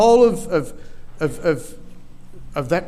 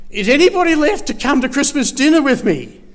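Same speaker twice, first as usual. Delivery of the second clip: lightly acted royal plea, higher and louder; King Charles; 8 semitones higher, 5 dB louder than usual. King asking who is left after the family murders, lightly acted plea. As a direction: call out a mock-anxious dinner invitation. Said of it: "if this was King Charles, he'd be asking".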